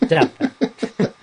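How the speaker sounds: amused doubt; laughing. Real, not acted. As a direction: scoff through a laugh. Laughing retort.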